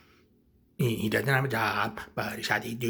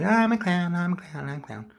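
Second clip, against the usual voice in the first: fading clown voice; 7 semitones higher than usual. Acted. Clown excusing himself, high voice fading away. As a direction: let the clown act peter out.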